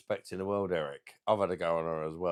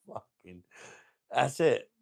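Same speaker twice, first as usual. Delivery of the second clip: ordinary talk, nothing marked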